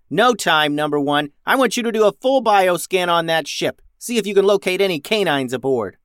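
high, loud captain's command; bossy young captain taking charge; urgent orders. A narrator's reading. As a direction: fire off orders impatiently.

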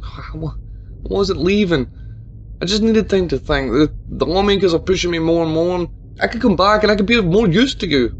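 begging not to be blamed; lightly voiced pleading mayor. A narrator's reading. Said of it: "mayor pleading with Tabitha".

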